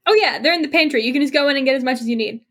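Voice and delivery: bubbly chatty dishwasher, upbeat; cheerful NPC. Acted. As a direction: play the friendly chatterbox coworker. GM voices cheerful NPC Kyle.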